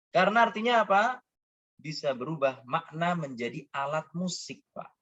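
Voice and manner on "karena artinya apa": usual speaking voice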